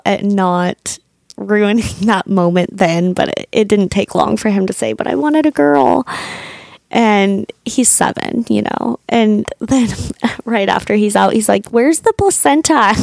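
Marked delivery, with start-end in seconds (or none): 4.9-6.1 put-on higher, a kid's mild disappointed whine; voicing her little son. Mother voices her son complaining. 11.7-12.9 put-on higher, a kid's curious question; voicing her little son. Mother voices her curious son.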